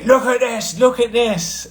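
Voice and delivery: high, insistent mock voice; imitating whiny housemate. Mock housemate showing her broken car window, whiny. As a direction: nag like the angry housemate.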